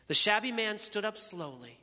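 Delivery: ordinary delivery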